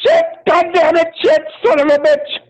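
high-pitched explosive cursing; comic high-pitched tantrum. Acted. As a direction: burst into hammed-up swearing. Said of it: "but I am explosive".

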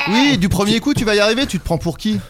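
loud, high-pitched teasing rebuke; mock scolding. Chiding a friend who gave up meditation, mock scolding. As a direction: tease them with exaggerated indignation.

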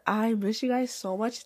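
usual speaking voice

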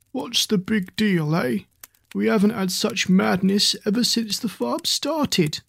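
a hint of gruff old horse. Old horse Bart speaks.

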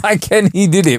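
mocking imitation. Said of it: "The fuck is".

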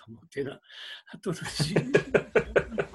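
mock panicked refusal. Retold refusal of megaphone.

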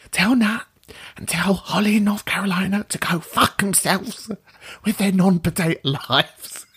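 whispered teasing message back; mock whisper. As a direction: whisper it like a secret voicemail. Mimics caller's whispered message.

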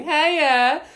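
high, loud, campy Hiya; camped-up greeting. Acted. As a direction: put on a sing-song camp hello to the barista. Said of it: "ready to camp it up".

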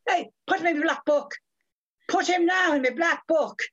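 high, emphatic Irish brogue; declaimed, high-pitched Irish accent; Victor McLaglen. Retold or imitated. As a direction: imitate a blustering big man's threat in an Irish accent. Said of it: "and Victor McLaughlin, the big man".

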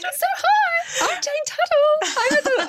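mortifyingly high, squeaky introduction; mock your own excited voice, way up high. Author re-enacting her festival introduction, absurdly high excited voice. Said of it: "And my voice was so high".

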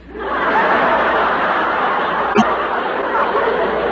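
big hearty laugh at his own joke; a belly laugh of self-delight; loud cackling laugh. Acted. [name] laughs at his own pun.

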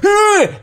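sudden high yelp; a sharp startled squeal; wasp attack yelp. Retold or imitated. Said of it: "came up and went".

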